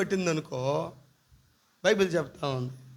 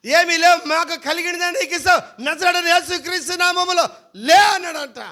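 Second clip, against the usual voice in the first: raised, loud preaching; full-force preaching; 7 semitones higher, 6 dB louder than usual. Acted. Pastor preaches the apostles' miracle, loud and raised. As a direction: proclaim it to the whole hall.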